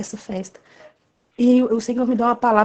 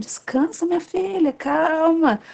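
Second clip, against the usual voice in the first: lightly voiced Lord soothing his daughter; God's tender voice; 6 semitones higher, slower than usual. God calming the anxious woman, high and gentle. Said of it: "o Senhor falando".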